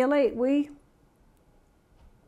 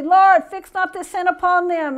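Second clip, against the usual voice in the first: "Lord, fix not this sin upon them" cried out loud and higher; loud cry; 5 semitones higher, 7 dB louder than usual. A narrator's reading. Stephen on his knees crying out to the Lord, loud and higher. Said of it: "he cried out loudly".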